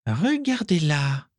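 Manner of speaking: high, slow disdain; disdainful sniff. A narrator's reading. Isalia pointing Mimi out to the group, disdainful. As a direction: draw it out with contempt. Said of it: "renifla Isalia avec dédain".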